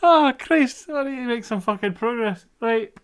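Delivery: helpless high laughing fit; cracking up, can barely speak; being tickled. Real, not acted. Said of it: "that's genuinely tickled me".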